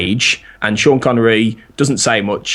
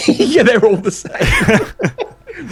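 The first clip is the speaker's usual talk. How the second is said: high, helpless laughing speech; cracking up while agreeing, pitched way up; 12 semitones higher, much slower than usual. Real, not acted. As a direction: talk through real laughter. Laughing at his own impressions.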